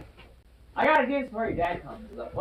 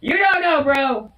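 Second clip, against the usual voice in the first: high, loud insistence; loud protest; 6 semitones higher, 7 dB louder, slower than usual. Real, not acted. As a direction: push back loudly. Scared of rats up there.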